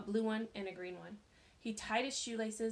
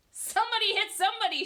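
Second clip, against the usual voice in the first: higher, louder, fast excited shout; 11 semitones higher, 12 dB louder, faster than usual. A narrator's reading. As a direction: egg the fighters on loudly. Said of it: "shouted Lori".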